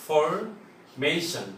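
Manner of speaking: Formation broken up, slow, with a pause after ফর; split into syllables. Shown as a demonstration. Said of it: "three syllable". (Formation শব্দটা ধীরে, সিলেবলে ভেঙে ভেঙে)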